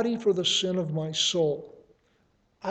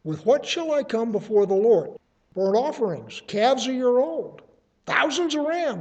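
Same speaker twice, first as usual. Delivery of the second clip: rising higher with each offering listed; incredulous rhetorical question; 5 semitones higher, 4 dB louder than usual. Acted. Prophet's worshipper asking what will please the Lord, incredulous. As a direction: voice the worshipper piling up offerings in disbelief. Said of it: "the rhetorical question is".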